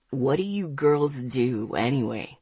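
low, slow male character voice; Ethan. A narrator's reading. Ethan asking the girl about her plans, low-voiced and slow. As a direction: drop low and take it slowly. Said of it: "Ethan asked".

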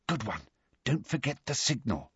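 soft hushed whisper. A narrator's reading. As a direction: whisper it close. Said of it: "Hermione whispered".

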